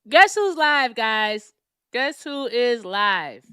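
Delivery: loud, high hype to the chat; excited announcement. Real, not acted. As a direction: break the news at full volume. Announcing another live streamer.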